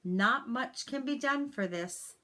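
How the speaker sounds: ordinary delivery, nothing marked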